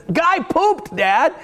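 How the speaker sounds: put-on high loud child calling out. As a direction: shout it like a proud toddler. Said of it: "he says it again very loudly".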